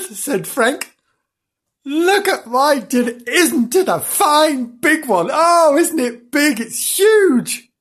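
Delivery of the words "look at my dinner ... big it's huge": high, slow, gleeful showing off; proud boasting. Acted. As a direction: marvel grandly, drawing out the words. Frank proudly shows off his dinner.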